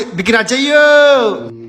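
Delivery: excited invitation. Real, not acted. Urging viewers, slow loud call.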